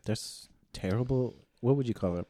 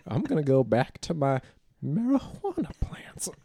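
put-on very old faint voice; 9 semitones higher, 3 dB louder than usual. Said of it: "You sound like that old guy from, uh, Family Guy".

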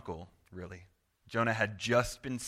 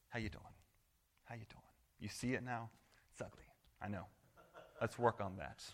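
gentle fatherly; 13 dB quieter, faster than usual. Pretend. God voiced gently to Jonah.

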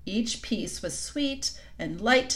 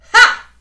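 sharp exclaim; 17 dB louder than usual. A narrator's reading. Queen exclaims.

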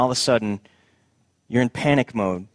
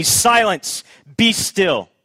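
higher, firm command to the storm; commanding rebuke; 6 semitones higher, 3 dB louder, much slower than usual. Acted. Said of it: "rebuked the wind and said".

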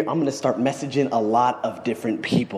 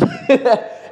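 loud, much higher laugh bursting out; high burst of laughter; 13 semitones higher, 6 dB louder than usual. Real, not acted. Vlogger laughing at his own imagined thanks, high burst of laughter. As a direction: let a real laugh burst out.

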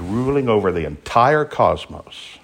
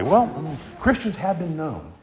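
ordinary talk, nothing marked

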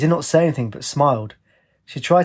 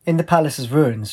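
usual speaking voice

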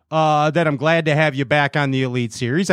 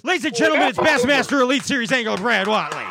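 talk show host; 8 semitones higher, faster than usual. Pretend. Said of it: "That's how you talk show".

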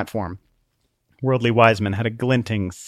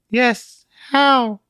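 trance-like; 13 semitones higher, 5 dB louder than usual. A narrator's reading. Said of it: "Truth's voice was trance-like".

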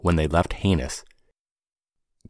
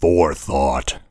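rumbling voice; 4 semitones lower, slower than usual. Acted. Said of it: "said someone with a rumbling voice".

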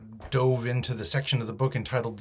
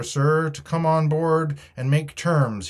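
slow, higher, louder call; shouting; 6 semitones higher, 7 dB louder, slower than usual. A narrator's reading. Mutineer asks the captain for terms, shouting. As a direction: call the message across in measured phrases. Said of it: "he shouted".

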